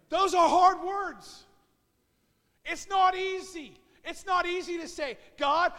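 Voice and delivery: fervent pulpit crescendo; preaching at full force. Acted. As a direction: build the congregation up line by line.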